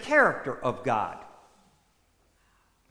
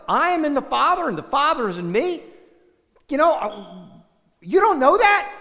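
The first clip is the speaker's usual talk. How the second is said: higher, louder, incredulous Jesus; exasperated; 7 semitones higher, 5 dB louder than usual. Retold or imitated. Jesus asks Philip in exasperation, higher and louder. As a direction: voice Jesus fed up with his disciples. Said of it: "Jesus, I think, is a little exasperated".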